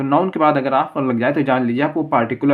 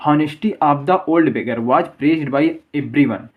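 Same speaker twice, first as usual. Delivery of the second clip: slow example reading; much slower than usual. Shown as a demonstration. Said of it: "अब तीसरा उदाहरण देखिए".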